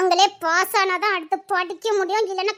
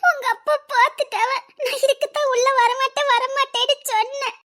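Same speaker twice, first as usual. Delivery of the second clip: high, tearful panicky whimper; frightened; 5 semitones higher than usual. Acted. Girl to boyfriend after his father saw them, frightened whimper. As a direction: whimper in fear of being caught. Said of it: "எனக்கு பயமா இருக்கு".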